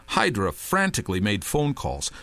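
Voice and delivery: usual speaking voice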